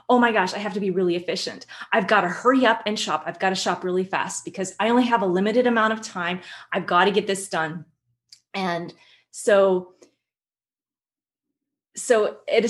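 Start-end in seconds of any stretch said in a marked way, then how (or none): 0.1-7.9 fast, rushed worried thinking; hurried inner thought. Retold or imitated. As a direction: rush through it like a frantic shopper. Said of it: "You're thinking".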